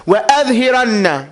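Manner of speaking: quick tajweed recitation of وَأَظْهِرَنَّ; recited Arabic. Shown as a demonstration. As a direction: chant وَأَظْهِرَنَّ fast, stressing the doubled noon. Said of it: "I say again".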